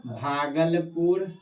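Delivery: lingering, stretched naming of Bhagalpur; slow drawn-out naming. Shown as a demonstration. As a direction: draw Bhagalpur out slowly. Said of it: "teacher naming district on map".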